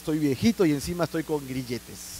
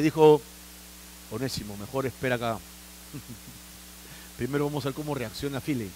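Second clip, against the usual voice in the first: hushed aside; 5 semitones lower, 7 dB quieter than usual. Acted. Messenger whispers at the door.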